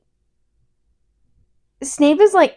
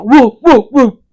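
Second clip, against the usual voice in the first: woof loudly like a big hound; imitated dog bark; 10 dB louder than usual. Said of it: "Probably not like that".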